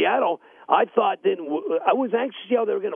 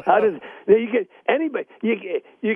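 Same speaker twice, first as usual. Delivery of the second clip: laughing; 7 semitones higher, 4 dB louder than usual. Real, not acted. Laughing at misspelled jersey name.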